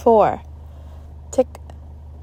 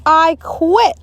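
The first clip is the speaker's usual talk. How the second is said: angry shout; 9 semitones higher, 7 dB louder than usual. A narrator's reading. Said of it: "she shouted".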